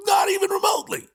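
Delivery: real furious shout, fast; angry shout. Real, not acted. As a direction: shout it out in outrage. Angry rant peaks.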